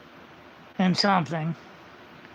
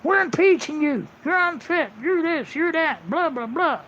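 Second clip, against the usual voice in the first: higher, sing-song mocking voice; mock sneering accusers, pitched up; mocking the Democrats; 6 semitones higher than usual. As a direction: parrot their accusations with scorn. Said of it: "they're gonna come in and say".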